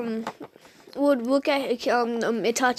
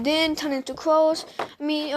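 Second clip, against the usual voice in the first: ordinary delivery, nothing marked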